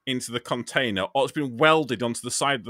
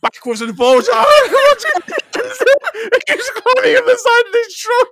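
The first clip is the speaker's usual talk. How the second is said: laughing; 11 dB louder than usual. Real, not acted. Laughing through a truck rant.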